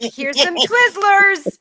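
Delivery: high, slow drawn-out mock announcement; hollered like unveiling a prize; mock shout. Friend mimicking a random snack box reveal, slow mock shout.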